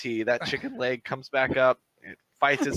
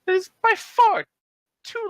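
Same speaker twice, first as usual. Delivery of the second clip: much higher, louder indignant goblin cry; goblin leader protest; 6 dB louder than usual. Acted. Goblin leader protesting the long wait, high loud squawk. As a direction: squawk the objection.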